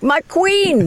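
adoring. Retold or imitated. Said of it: "I was like".

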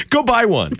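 high-pitched mock-kid comeback; retold taunt. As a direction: throw it at the neighbor kid as a gotcha. Taunting kid before slamming door.

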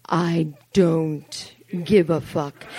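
slow, weighty, from the gut; slow gut-level resolve; deep-felt declaration. Acted. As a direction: let it come up slowly from deep inside. Deep realization at forty-three.

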